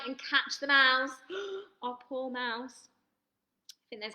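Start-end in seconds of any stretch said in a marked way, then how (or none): 1.1-1.8 mock shocked gasp. Gasp at mouse being caught. 1.8-2.9 mock pity. Pity for the threatened mouse.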